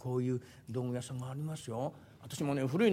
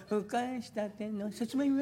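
higher, slow, drawn-out sweet potato call; soft, steamy vendor's cry; 6 semitones higher, slower than usual. Acted. Vendor selling freshly steamed sweet potatoes, soft and steamy. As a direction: sing it out soft as rising steam. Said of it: "湯気の出てるように柔らかそうに".

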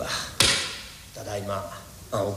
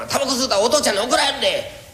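higher, quicker mock warning with a laugh; retold scolding; 9 semitones higher, faster than usual. Wife retells warning her son, higher and laughing. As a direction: lightly imitate scolding the son. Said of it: "何度注意したんか言うたがな".

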